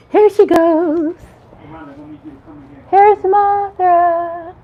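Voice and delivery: high, slow, stretched-out presentation; drawn-out announcing. Real, not acted. Vlogger presenting Martha to viewers, drawn-out announcing.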